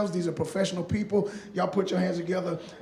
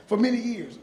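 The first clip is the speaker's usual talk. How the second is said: ordinary talk, nothing marked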